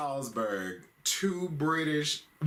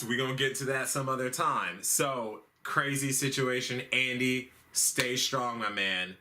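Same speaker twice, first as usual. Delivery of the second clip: ordinary talk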